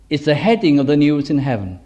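normal talking voice

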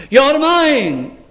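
high, emphatic claim of ownership; the Lord's declaration. Acted. The Lord speaking to the believer, emphatic loving claim. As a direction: declare it with a lifted, possessive cry. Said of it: "And so the Lord is saying".

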